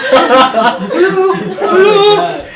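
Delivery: high mock call; calling out hello. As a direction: imitate a trapped man calling out. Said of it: "He gets up and goes".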